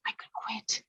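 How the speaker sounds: whispered, barely there; hushed realisation. Retold or imitated. Speaker confiding her secret way out, whispered. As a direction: say it under your breath. Confides the choice she decided on.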